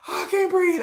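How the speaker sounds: high mock wheeze; breathless from laughing. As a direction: act out being winded by laughter. Imagined laughing too hard.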